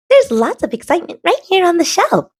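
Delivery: high, fast little acorn voice; chirpy small acorn; a high quick voice for the acorn. A narrator's reading. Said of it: "says the acorn".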